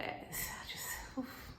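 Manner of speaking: hushed, overcome sigh; swooning whisper. Real, not acted. The storyteller swooning over Boaz, whispered.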